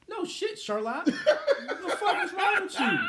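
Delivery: loud, slow, high mock outrage with a laugh; exasperated outburst. Telling his ex off in a loud, laughing disbelief. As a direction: blast her with disbelief.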